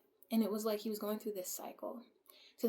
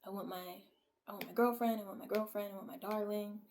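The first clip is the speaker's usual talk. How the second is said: normal talking voice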